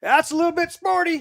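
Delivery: a hint of a cool officer's one-liner; calm soldier quip. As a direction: toss it off cool while bullets fly. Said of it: "And he goes".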